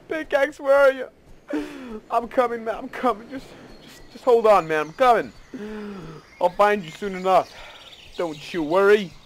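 high, sing-out call to something lost; mock-reassure it as you hurry over; playful calling out. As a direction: pretend rescuer calling ahead, high-pitched. Playfully calls out to lost pickaxe.

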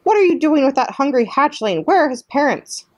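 high, scolding goose voice; squawking goose. A narrator's reading. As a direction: squawk your outrage at the robot. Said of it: "squawking to the robot".

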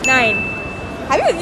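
usual speaking voice

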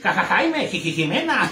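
Ja, ja, Jaime, Ji-, Ji-, Jimena with stuttered first syllables, laughing; stuttered names. Retold or imitated. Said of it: "Son tartamudos". (tartamudea Ja, ja, Jaime y Ji-, Ji-, Jimena entre risas)

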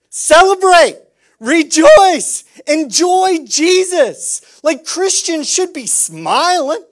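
high, loud joyful commands; loud exhortation. Real, not acted. Preacher exhorting the church to rejoice, loud and high. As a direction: call it out like a rally. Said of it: "exhorting congregation loudly".

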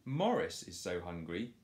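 ordinary delivery, nothing marked

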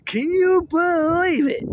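high, loud, drawn-out disbelief; incredulous outburst; amazed. Real, not acted. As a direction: squeal it slowly in amazement. Amazed at the story's turn.

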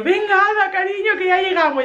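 high, coaxing mother voice; calm the crying baby; soothing. Retold or imitated. Mother soothes the crying baby in the car, high and coaxing.

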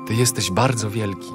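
normal talking voice

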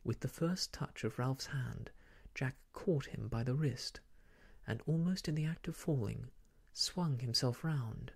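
usual speaking voice

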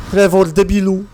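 yelling at Trevor in the game; calling out. Acted. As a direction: shout across at him, annoyed.

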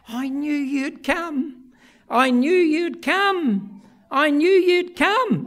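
put-on slow, rising child's voice repeating it; a little boy reassuring himself, building; voicing his son. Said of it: "he kept saying to himself".

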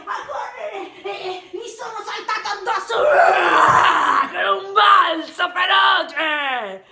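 high, loud, breathless rush; frantic shouting. Acted. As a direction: burst out in fierce excitement. Furious lion attack.

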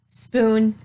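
ordinary delivery

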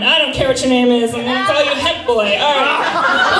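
normal talking voice

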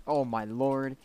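yelling at a mad friend in the creek; shocked shout. Real, not acted.

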